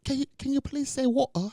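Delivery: high mock British voice; mock British fan. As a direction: ham up an eager British fan begging. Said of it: "'cause they be like".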